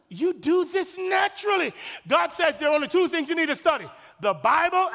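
full-force preacher exhortation; preaching shout. Real, not acted. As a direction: shout it to the congregation.

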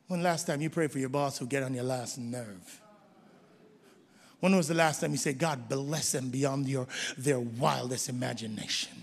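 quiet probing. Acted. Pastor quietly challenging listeners about prayer, soft. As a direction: ask it gently, almost under the breath.

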